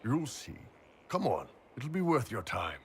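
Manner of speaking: ordinary talk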